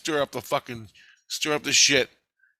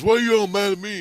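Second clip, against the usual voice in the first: whiny complaint; 10 semitones higher, 5 dB louder, faster than usual. Retold or imitated. Quoting the guy's whiny complaint.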